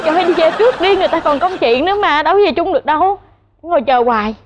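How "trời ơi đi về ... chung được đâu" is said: higher-pitched retold exasperation, lightly acted; mock-exasperated shooing, pitched up; exasperated urging. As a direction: retell it like you are nagging someone to go. Retells urging him to leave.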